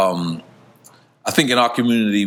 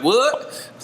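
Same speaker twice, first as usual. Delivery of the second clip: Miami imitation; 10 semitones higher than usual. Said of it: "The way they be like".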